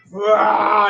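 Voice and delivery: short loud roar; orc battle cry. Acted. Orc leaping at the spider queen, roaring. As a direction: let out a war cry as he leaps.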